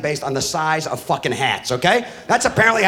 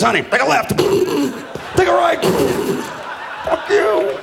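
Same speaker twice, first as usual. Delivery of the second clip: mock saint directing a driver, then snapping; hammed-up navigator turning rude; lightly voiced saint, laughing; bad directions voice; 7 semitones higher than usual. Saint Christopher giving bad directions.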